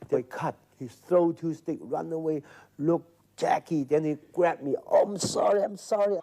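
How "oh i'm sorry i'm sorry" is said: high, pleading apology; apologetic high voice. Retold or imitated. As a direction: beg forgiveness in a high voice. Retold apology from fight.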